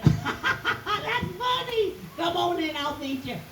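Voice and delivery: high, laughing, drawn-out voice; amused giant's wife. Acted. Giant's wife laughs at Jackie's boldness.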